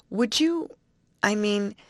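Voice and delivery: high, hesitant false starts; halting, tentative high voice; half stammering. Acted. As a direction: falter and restart an awkward offer. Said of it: "half stammered Betty".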